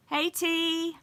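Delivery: high, bright hello to her sister; light up greeting little T; excited greeting. Real, not acted. Greeting her little sister who just joined, high and excited.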